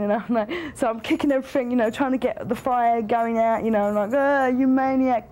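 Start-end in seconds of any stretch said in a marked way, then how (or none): 4.1-5.3 a slower mock yell, pitched up at the husband; exasperated yell. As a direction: throw it at him like a half-laughing scolding. Fighting the fire he set.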